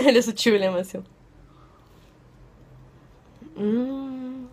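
talking between bites; chewing with mouth full. Real, not acted. Seen 3: Bites brownie at 1.3–2.5, then chews with closed full mouth.

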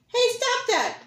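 high, loud angry rabbit; mad rabbit. Acted. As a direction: snap at him as a cross bunny. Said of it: "hopping mad".

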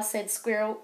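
ordinary delivery

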